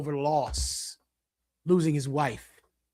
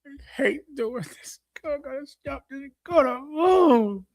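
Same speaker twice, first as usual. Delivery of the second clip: high, pained self-loathing voice, breaking into a cry; mock alcoholic despair; 7 semitones higher than usual. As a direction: play a drunk hating himself, voice cracking. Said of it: "That's them".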